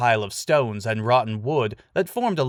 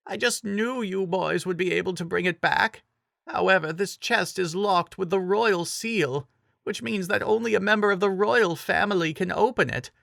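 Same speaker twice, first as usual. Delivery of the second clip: put-on old woman; 8 semitones higher than usual. A narrator's reading. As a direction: speak gently as the kindly old Impa. Old woman Impa speaks.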